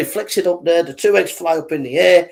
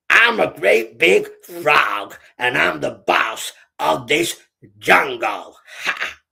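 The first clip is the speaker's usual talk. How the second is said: slow, boastful frog boss; bullying big frog; slower than usual. Acted. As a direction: drag out each word as a cocky bully. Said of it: "He was a bit of a bully".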